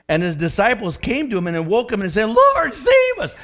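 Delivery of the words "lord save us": high, slow desperate plea; frantic high call to the Lord; panicked cry for help. Acted. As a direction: cry out like men about to drown. Disciples cry out in storm.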